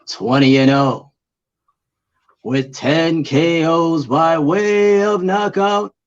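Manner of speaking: slow ring announcer, rising high and loud; boxer announcer voice. Pretend. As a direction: build the name into a big high shout. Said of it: "boxer announcer voice".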